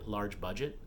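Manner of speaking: ordinary talk, nothing marked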